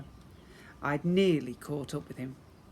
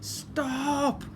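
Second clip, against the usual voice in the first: high loud croaking cry; old goblin croaking stop; wheezy croak; 11 semitones higher, 5 dB louder than usual. Acted. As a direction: wizard croaking a command. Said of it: "a wheezy voice croaked".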